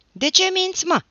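high furious yell across the yard; angry accusing shout. A narrator's reading. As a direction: hurl it at the neighbour loudly. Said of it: "strigă Birică".

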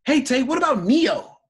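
high, loud mock pestering question; imitating eager follower. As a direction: call it out like an over-eager fan.

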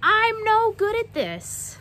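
high, loud and slow wail; loud drawn-out complaint; despairing shout. Acted. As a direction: cry it out in defeat at the piano. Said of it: "she would shout".

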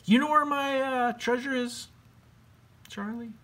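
very high, sing-song ghost voice; friendly ghost. Acted. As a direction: float it up high like a goofy ghost. Ghost asks the bat.

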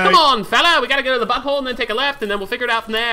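high, loud mock-jolly voice; rally your fella in a put-on high voice. Playful put-on voice rallying his friend.

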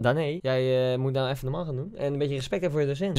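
quiet, low telling-off of a pupil; berispende leraar. Acted. Leraar berispt Danae in het verhaal. (licht gespeelde docent, laag en ingehouden)

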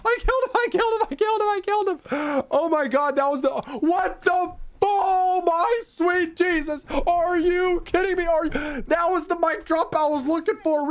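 high-pitched excited screaming; ecstatic disbelief. Real, not acted. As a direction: scream it out, stunned by the win. Stunned by a sudden game win.

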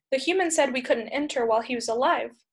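plain reading voice

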